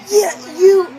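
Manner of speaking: high, loud, half-crying "you"; friend's yell. Retold or imitated. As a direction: snap it back at her, loud. Friend yelling at the narrator.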